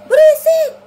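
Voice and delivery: high, piercing mock-mama shout; imitated mother's yell. Anto imitates mama scolding his noise, shrieking. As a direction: shriek it like a fed-up mum. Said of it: "mamaku teriak".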